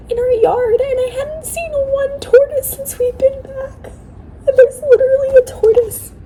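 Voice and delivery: high, tearful crying voice. Real, not acted. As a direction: cry through the words. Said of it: "I'm crying over a tortoise".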